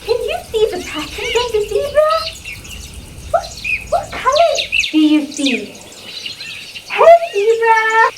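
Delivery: put-on bright, high teacher voice for kids; sing-song wonder for little ones; overbright kids party host. Teacher exploring zoo video.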